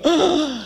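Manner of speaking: acted gasp of wonder; amazed gasp. Dad mimics daughter hearing the idea, amazed gasp.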